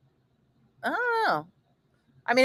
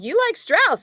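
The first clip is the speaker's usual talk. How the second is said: high questioning salesman; mock rep voice; 12 semitones higher, 3 dB louder than usual. Imitating the dairy rep at the store, high. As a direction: lift it up like a curious rep. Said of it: "and he's like".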